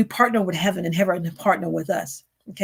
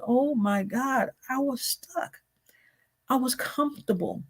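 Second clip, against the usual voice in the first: hushed confession; 3 semitones higher, 5 dB quieter than usual. Retold or imitated. Realization voiced as inner confession.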